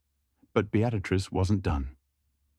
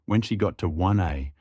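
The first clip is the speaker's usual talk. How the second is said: ordinary delivery, nothing marked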